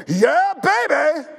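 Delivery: a smug, drawn-out victory cry; mock triumphant cheer. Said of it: "most of us go".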